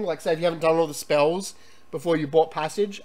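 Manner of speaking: ordinary talk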